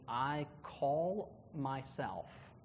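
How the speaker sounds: slow, word by word literal gloss. Shown as a demonstration. Teacher spelling out a literal Spanish gloss, slow and deliberate. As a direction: spell out each word slowly. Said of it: "very literally, that means".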